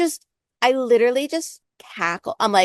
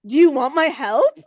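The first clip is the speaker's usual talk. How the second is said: louder, higher put-on impatience; impatient demand; 4 semitones higher, 5 dB louder than usual. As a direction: push the question at her. Impatient tarot reader.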